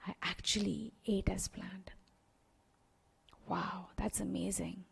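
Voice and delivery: quiet self-congratulation in a whisper; whispered praise. Shown as a demonstration. Coach showing listeners how to praise themselves, whispered. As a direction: whisper it to yourself, softly pleased. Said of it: "you can say things like".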